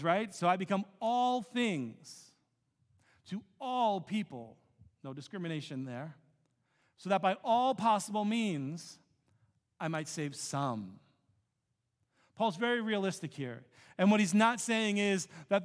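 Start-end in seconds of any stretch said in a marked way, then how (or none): 0.3-11.0 each "all" in "all things to all people" slowed and leaned on; stress on all. Shown as a demonstration. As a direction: hit every "all" as a determiner, slowly. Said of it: "these are the determining words".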